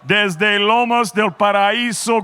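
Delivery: usual speaking voice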